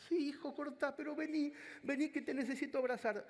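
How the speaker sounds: very high, eager imitating mother; overjoyed mother. Mother promising to cook for her son, very high delighted voice. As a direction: agree at once, delighted.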